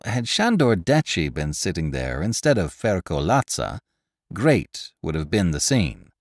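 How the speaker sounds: plain reading voice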